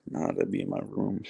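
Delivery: echoey basement room sound; talking in a hollow basement. Real, not acted. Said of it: "I'm in the basement right now".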